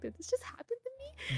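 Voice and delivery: high, thin, incredulous question; can't believe this happened; stunned disbelief. Retold or imitated. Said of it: "I'm like".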